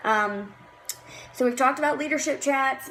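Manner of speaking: normal talking voice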